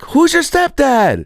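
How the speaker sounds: put-on very high, slow, drawn-out kid voice; kids asking. The gang of kids asks the main kid, slow high voice. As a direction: stretch the question out like a baffled kid. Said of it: "everyone's like".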